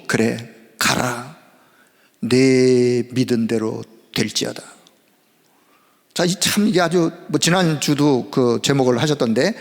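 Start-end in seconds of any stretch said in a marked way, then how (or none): 0.1-4.6 voicing Jesus. Jesus speaks the healing word.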